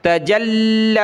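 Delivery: تجلی with the لام pressed and held a beat; doubled lam. Shown as a demonstration. Teacher demonstrating shadda on لام, lam held hard. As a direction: stop and lean on the لام in تجلی. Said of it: "لام پر سختی کر رہا ہوں، رک رہا ہوں".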